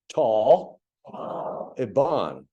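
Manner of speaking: "tall" loud, then "Iban", split apart; syllables tall Iban. Shown as a demonstration. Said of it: "if you would repeat after me".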